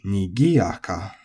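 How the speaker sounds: nigiyaka, slowly, accented on gi. Shown as a demonstration. Teacher demonstrating Japanese pitch accent to learners, accent on gi. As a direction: lift the gi in nigiyaka, then drop. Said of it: "with the accent on the gi".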